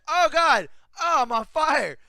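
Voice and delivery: loud, high hammed-up wails; mock dying screams. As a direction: cry out as if on fire, imitating her. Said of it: "It sounded like she was dying".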